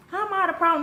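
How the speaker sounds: high-pitched, incredulous comeback; indignant retort. Retold or imitated. Tenant answering those who blame her, indignant.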